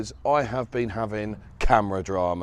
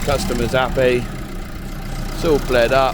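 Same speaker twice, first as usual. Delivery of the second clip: louder, talking over engine noise; raised voice; 2 semitones higher, 5 dB louder than usual. Real, not acted.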